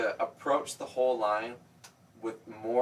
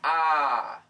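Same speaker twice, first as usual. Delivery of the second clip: resonant; 5 semitones higher, 6 dB louder than usual. Shown as a demonstration. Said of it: "you're not resonating".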